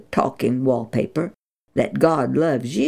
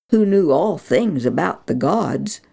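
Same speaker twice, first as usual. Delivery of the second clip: plain reading voice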